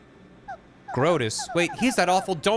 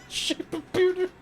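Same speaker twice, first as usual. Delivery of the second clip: much higher, slow, giggling; squeaky mock repeat. The player mocking his own pun, high and giggly. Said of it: "mock repeat".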